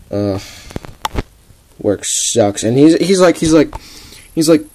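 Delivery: ordinary talk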